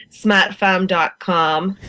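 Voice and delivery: smatfam dot com with broad Boston a, slow; Boston accent. Shown as a demonstration. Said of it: "or as we say in a Boston accent".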